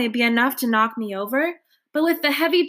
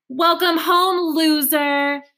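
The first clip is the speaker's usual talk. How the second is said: slow, louder bully bellow; 6 semitones higher, 4 dB louder, slower than usual. A narrator's reading. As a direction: bellow the taunt. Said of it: "bellows Bear".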